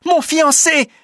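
very high, loud exclamation; woman's cry. A narrator's reading. Marie sees her fiancé alive, very high cry. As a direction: shriek it out to your beloved. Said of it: "crie Marie".

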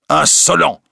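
crie «Insolent» plus haut et plus fort; cri indigné. A narrator's reading. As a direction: lance l'insulte avec indignation. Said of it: "crie l'évêque". (l'évêque crie à Petit-Jean, indigné)

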